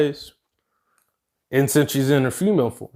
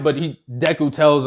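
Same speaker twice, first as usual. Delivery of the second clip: ordinary delivery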